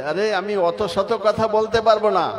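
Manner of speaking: dying mother annoyed at her son's nagging; angry retort. Retold or imitated. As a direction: act out the mother's irritated outburst. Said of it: "রেগে মেগে বলছে".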